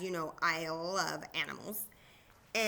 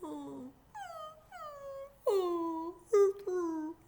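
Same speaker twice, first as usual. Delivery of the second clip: high, drawn-out sad dog whimper; 11 semitones higher, much slower than usual. Acted. Teacher showing children the feelings game, sad dog whimper. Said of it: "act like a sad dog".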